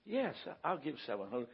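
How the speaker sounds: soft, low, yielding reply; gentle quoted agreement. Retold or imitated. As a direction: give in quietly, as if won over. Acts his won-over soft reply.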